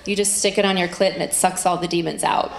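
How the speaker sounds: ordinary talk, nothing marked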